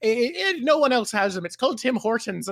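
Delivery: imitating an Irish person, lightly put-on Irish lilt; a hint of an Irish accent. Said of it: "but I'm imagining their accent".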